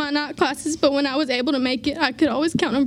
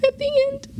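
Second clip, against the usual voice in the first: voice breaking; 9 semitones higher than usual. Real, not acted. Crying during speech.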